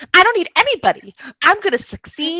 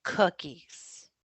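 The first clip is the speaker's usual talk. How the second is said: leaning into mic; 10 semitones lower, 16 dB quieter than usual. Acted. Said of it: "back into the mic".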